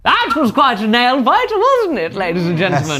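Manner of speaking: showman wrapping up the match; big host-style sign-off; announcer voice. Acted. Host addresses the audience.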